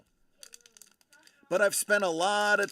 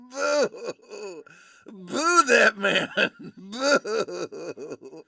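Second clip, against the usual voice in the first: booing; 3 semitones higher, 7 dB louder, much slower than usual. Acted. Booing a bad pun.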